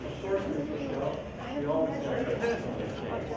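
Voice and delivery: high mock student reply; a touch of a sing-song student voice; lightly voiced students. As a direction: chirp back as a dutiful class. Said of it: "Do you all have pencils".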